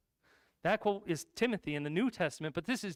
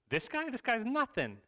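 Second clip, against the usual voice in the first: high-pitched mock disbelief; incredulous scoff; 6 semitones higher than usual. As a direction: scoff in raised pitch as young David. David scoffs at the giant.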